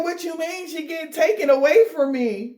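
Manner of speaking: high, shocked mock outrage; hammed-up stunned lover; shocked disbelief. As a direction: squeal it like they can't believe it. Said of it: "They're like".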